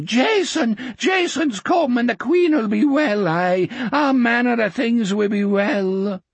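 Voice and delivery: put-on high, quavering old man in joyful tears; weeping old man. A narrator's reading. Old depot keeper kneeling before Jack, weeping with joy. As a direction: babble it out in tearful awe.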